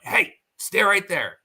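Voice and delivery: high, slow call to halt. Retold or imitated. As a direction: stretch it out to stop her in place. Calling out to stop the animal.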